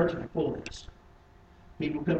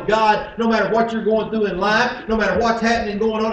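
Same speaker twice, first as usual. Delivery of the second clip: loud, raised preacher's delivery; higher, louder sermon voice; forceful preaching; 7 semitones higher, 11 dB louder than usual. Acted. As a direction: drive it home from the pulpit.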